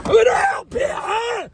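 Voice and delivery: high, loud strained cry for help; frantic shout. Real, not acted. Driver crying out for help while fumbling a burning cigar. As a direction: yell it out half in pain.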